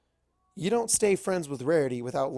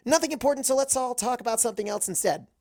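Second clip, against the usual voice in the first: too emphatic; 9 semitones higher, faster than usual. Acted. Twilight hastily changes the subject, too emphatic. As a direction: shut the topic down fast. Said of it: "more emphatically than I meant to".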